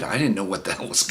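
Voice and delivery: ordinary delivery, nothing marked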